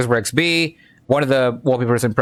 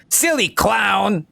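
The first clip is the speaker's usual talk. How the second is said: high, slow crackpot jeer; hammed-up paranoid retort; crazy conspiracy nut; 9 semitones higher, much slower than usual. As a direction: fling it out in a high, drawn-out voice. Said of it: "Tinfoil Head user replies back".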